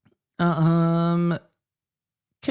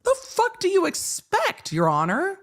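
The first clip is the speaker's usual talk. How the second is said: incredulous retort. Retold or imitated. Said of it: "And I'm like".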